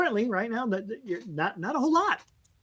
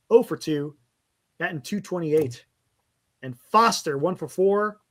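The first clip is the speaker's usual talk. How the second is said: ordinary delivery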